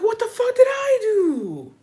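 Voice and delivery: high, slow, stunned disbelief; shocked what the fuck. Retold or imitated. Retelling being unfollowed, high stunned disbelief. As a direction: gasp it out like you can't believe it. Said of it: "and I was like".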